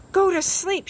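excited shout. A narrator's reading. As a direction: call it out gladly, the last thing on his list. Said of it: "shouted Toad".

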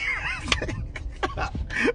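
breathless high-pitched cackle; squeaky high laugh. Real, not acted. Vlogger cracking up mid-story. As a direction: burst into a wheezy squeal. Laughing at her own bit.

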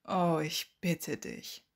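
slow, drawn-out scoff; dismissive sneer, taking its time; mocking. A narrator's reading. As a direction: stretch it out with lazy derision. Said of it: "spottete Severus".

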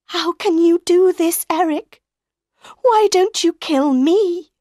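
high, loud, desperate plea; anguished outcry. A narrator's reading. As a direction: cry out at the captor in horror. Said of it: "she found her voice again".

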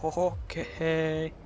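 slow high oho, okay; startled amused chuckle; amused surprise. Real, not acted.

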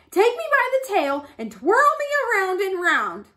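high, loud character voice; urgent Rabbit. A narrator's reading. Rabbit tricking the lion, urgent high voice. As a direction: coax with big lively swoops.

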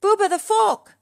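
high, loud warning shout; urgent high-pitched holler. A narrator's reading. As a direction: shout urgently to someone running past. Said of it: "shouted Gobo".

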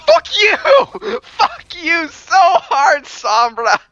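very high, loud, drawn-out cursing; outraged laughing yell. Real, not acted. Player killed by Sombra, high outraged screaming.

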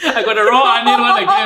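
high laughing outcry. Real, not acted. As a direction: laugh in disbelief. Gets the raw onion shot again.

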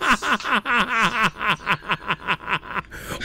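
breathless fit of laughter; laughing. Real, not acted. Streamer laughing at the raided woman, breathless laughter. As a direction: laugh helplessly.

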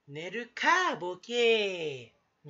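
loud, stretched-out mock comeback; hammed-up tsukkomi with a long final vowel; boring comic's retort. Playing a dull comic scolding himself for rambling, loud mock retort. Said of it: "つまらないやつのツッコミ".

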